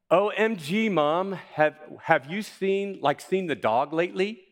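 put-on high, loud valley girl accent with "like" and "O M G"; bubbly California teen voice, pitched high. Shown as a demonstration. Preacher plays a California girl to show the church dialects.

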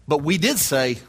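ordinary talk, nothing marked

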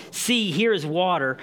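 "See, here is water." as an eager exclamation, HERE lifted; exclaimed. Shown as a demonstration. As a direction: burst out with it, excited to have found water. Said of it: "No, it's an exclamation, exclamation mark".

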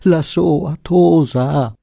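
high, very drawn-out cry; distraught wail. A narrator's reading. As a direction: wail the news slowly.

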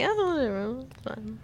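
soft, careless shrug of a voice; dismissive mumble. Retold or imitated. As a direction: brush it off quietly. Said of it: "I just would have been like".

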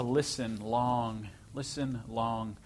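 slow, drawn-out listen long; listen long, said slowly and firmly; slow emphasis. Shown as a demonstration. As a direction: stretch each word, slowly. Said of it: "emphasis on listening long".

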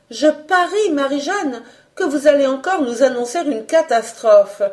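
high, lightly teasing guess; untroubled teasing. A narrator's reading. Colette to the agitated cook, making light of it. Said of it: "sans prendre les choses au tragique".

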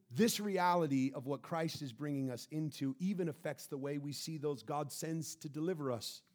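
ordinary delivery

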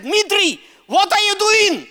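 high, loud cry of disbelief; alarmed confrontation. Retold or imitated. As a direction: call out your friend in alarm. Retells confronting his friend in office.

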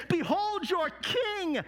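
high, drawn-out announcement to a crowd; proclaiming. Acted. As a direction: present him grandly, stretching the words. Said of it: "and he brought him out and said".

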